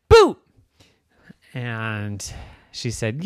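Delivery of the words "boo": high loud playful boo; mock boo. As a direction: jump out and shout boo. Mock scare.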